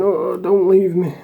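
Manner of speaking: slow, high, whimpering plea; mock crying. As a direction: imitate a detoxing hardman sobbing. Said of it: "crying and saying".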